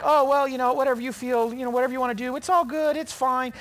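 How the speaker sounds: high, loud mock-breezy parent; hammed-up carefree parent voice; permissive parent. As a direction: toss it off like a parent who never says no. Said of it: "And the parents are like".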